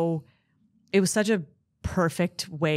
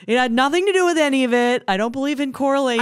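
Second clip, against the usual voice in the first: mock carefree voice; 7 semitones higher, 5 dB louder, faster than usual. Mocking denial, riffing along.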